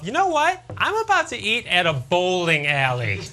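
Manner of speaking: high sarcastic realisation; deadpan self-mockery; sarcastic inner voice. Comic sarcastically answering the grill's warning sign, pitched high. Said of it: "I'm thinking to myself".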